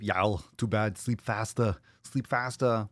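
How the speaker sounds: mock Austrian strongman voice; Arnold Schwarzenegger. Streamer dismisses a sleepy viewer, Arnold impression. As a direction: scold like an Austrian bodybuilder. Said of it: "Arnold voice".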